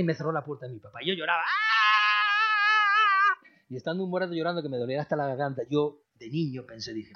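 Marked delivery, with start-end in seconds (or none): 1.4-3.4 high wailing sob; high mock child's wail; child's crying wail.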